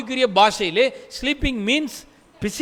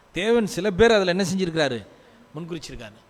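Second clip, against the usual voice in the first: ordinary talk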